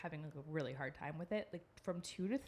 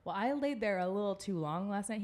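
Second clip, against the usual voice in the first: ordinary talk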